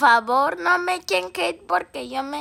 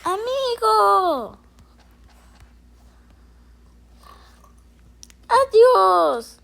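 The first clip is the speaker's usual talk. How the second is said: slow, loud, higher goodbye; dramatic toy farewell; 6 semitones higher, 7 dB louder, much slower than usual. Pretend. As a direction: call out a farewell as the toy falls.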